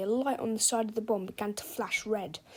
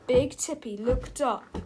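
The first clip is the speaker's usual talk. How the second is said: ordinary delivery, nothing marked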